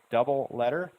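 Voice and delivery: usual speaking voice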